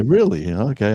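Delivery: a touch of surprise; mock surprise. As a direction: play the owner caught off guard. Owner's surprised reply voiced.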